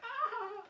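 high-pitched "ah", imitated; mimicked wail of laughter. Showing how they looked in the photo, high mimicked cry. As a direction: let out a high mock cry. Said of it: "you can see I'm just like".

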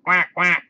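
high, loud short quacks; duck quacking. A narrator's reading. Duck calling to the busy spider, quacking. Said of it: "called the duck".

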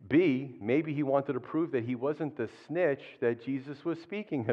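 normal talking voice

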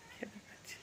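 hushed unvoiced whisper. Real, not acted. Cook telling viewers she can taste the oil, whispered. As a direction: drop to a breathy whisper mid-sentence. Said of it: "কি আস্তে আস্তে বলছি না?".

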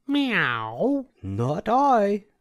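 high meowing cat voice; a cat mewing its refusal. A narrator's reading. Cat refusing to help the hen with a high meow. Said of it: "said the cat".

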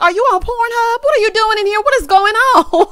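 high, loud, rapid-fire accusing questions; a hammed-up outraged wife grilling him; mock interrogation. Imagined confronting husband in bathroom.

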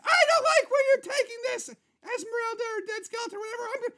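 [name]. Acted. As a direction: protest up high like an affronted star. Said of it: "it's [name]".

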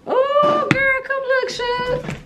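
very high, loud excited call; excited squeal. Real, not acted. Mom calling Suge to come look, excited squeal. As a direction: squeal it out to call her over. Calls child over to look.

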